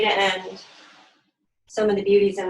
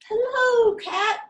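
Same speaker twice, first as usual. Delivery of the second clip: very high, loud duck hello; cheery duck greeting; 4 dB louder, much slower than usual. Acted. Duck waving to Sheep from the bike, very high. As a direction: squeal out the greeting like a proud duck.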